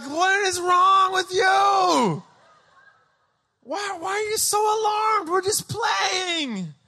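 high, drawn-out whiny protest; hammed-up kid wailing in bafflement; indignant child. Little boy questioning alarmed mom, indignant child.

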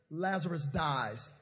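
usual speaking voice

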